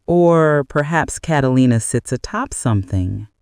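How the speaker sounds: plain reading voice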